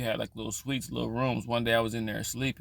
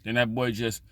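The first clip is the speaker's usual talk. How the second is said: usual speaking voice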